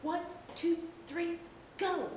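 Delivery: shouting. Acted. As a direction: shout the countdown eagerly. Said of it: "shouted Peppa".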